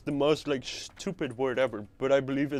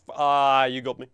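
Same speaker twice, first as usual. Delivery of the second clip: ordinary talk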